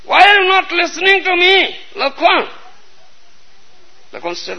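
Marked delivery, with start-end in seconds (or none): 0.0-2.5 higher, indignant demand; angry reproach. A narrator's reading. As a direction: scold him for not listening. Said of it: "became angry".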